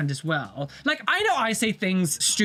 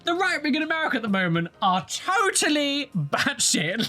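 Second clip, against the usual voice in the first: laughing mock announcement; 7 semitones higher than usual. Whole world voicing verdict.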